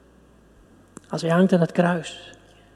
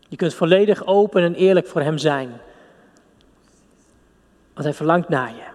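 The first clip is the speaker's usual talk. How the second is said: normal talking voice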